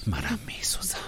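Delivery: whisper. Acted. Intimate threat.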